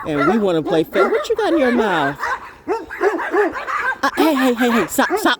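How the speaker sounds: loud, high and laughing; excited laughing scold; laughing at dogs. Real, not acted. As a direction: laugh while telling the dogs to stop. Dogs jumping, laughing at them.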